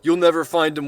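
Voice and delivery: loud defiant yell. Acted. Agent yells at Gary, loud and defiant. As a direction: shout it back at him. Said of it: "one of the agents yelled".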